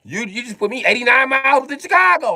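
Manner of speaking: high, loud comic alarm at the distance; outraged protest. Acted. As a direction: protest with the voice shot right up. Outraged reaction, repeated lower after.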